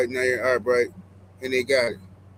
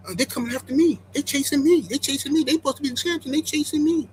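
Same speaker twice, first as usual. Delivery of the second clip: pitched-up whiny mock of a rival; mocking rival boxers claiming Haney chases them, pitched up; mocking imitation; 8 semitones higher than usual. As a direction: whine it like a rival who claims he's hunted. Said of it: "both of them are saying".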